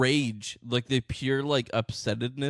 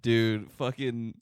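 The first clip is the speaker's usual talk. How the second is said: usual speaking voice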